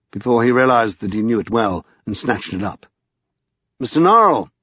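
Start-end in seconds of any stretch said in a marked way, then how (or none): 3.8-4.5 raised, louder urgent call; crying out. A narrator's reading. Lascelles alerting Norrell to an important letter, crying out. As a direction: call out his name high and urgently. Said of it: "he cried".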